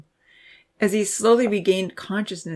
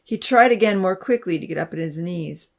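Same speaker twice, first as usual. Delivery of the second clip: usual speaking voice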